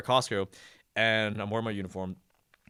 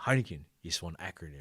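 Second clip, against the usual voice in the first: lightly imitated Samoan Pidgin, trailing softer; butchered Samoan accent; 5 semitones lower, 5 dB quieter than usual. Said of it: "I'm gonna butcher the accent".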